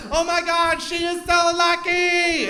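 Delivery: high, slow, loud cooing; envy the bride in a squeal; gushing clerk. Retold or imitated. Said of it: "she's like".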